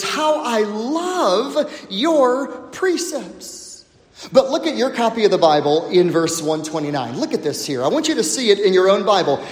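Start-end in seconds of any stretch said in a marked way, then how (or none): 0.0-3.8 David's words. Retold or imitated. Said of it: "David says".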